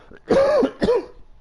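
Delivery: sharp high barked cough-laugh. Acted. Old woman barks a laugh as the boys flee, sharp and high. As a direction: bark out one harsh laugh. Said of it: "barked out a single laugh".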